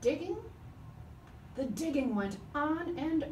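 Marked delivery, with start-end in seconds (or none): none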